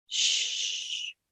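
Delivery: soft unvoiced shush; hush. Teacher hushing to mimic rain sounds.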